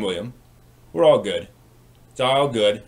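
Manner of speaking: normal talking voice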